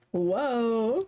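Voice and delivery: "Whoa" drawn out with a small lift at the end; mild awed whoa. Shown as a demonstration. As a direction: let "Whoa" stretch out in wonder. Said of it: "Probably more like a whoa".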